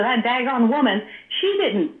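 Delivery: indignant imitated southern speaker; southern-accented mock indignation, pitch jumping up. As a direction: retell his outrage in a southern accent. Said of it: "you know, with a southern accent".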